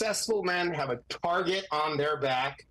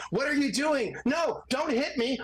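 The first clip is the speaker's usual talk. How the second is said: higher, hammed-up fake panic; mock panicked victim; 9 semitones higher than usual. Lawyer mocking staged courtroom recordings, higher mock panic. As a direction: parody a staged cry for help. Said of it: "I've heard the".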